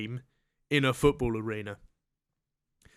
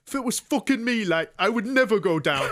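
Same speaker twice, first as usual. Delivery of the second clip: tough guy voice; 8 semitones higher, 4 dB louder than usual. Retold or imitated. Mocking tough-guy fans.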